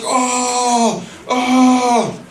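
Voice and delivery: hammed-up "oh" cries, held long; mock sexual screaming. Husband mimics her screaming in bed, drawn-out mock wail.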